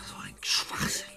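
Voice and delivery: hushed, scornful whisper; soft contemptuous whisper; whispered scoff. Acted. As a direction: scoff to himself. Scoffing under his breath.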